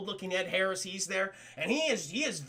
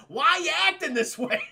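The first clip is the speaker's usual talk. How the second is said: higher, louder imitated complaint; mock exasperation; 6 semitones higher, 5 dB louder than usual. Everyone reacts to the serious agent, mock exasperated. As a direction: plead in disbelief at someone too serious.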